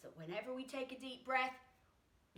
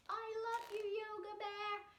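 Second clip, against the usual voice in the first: a higher, cute bear voice; Yoga Bear voice; 11 semitones higher than usual. Acted. Teacher voices the toy bear saying its affirmation in a higher cute voice. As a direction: speak for the cuddly bear puppet.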